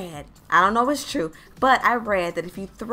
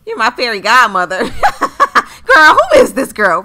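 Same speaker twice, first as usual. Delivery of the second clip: loud, incredulous laughing reaction; laughing disbelief; 10 dB louder, slower than usual. Acted. Girl scoffs at self-declared fairy godmother, loud laughing disbelief. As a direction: burst out laughing in disbelief, loudly.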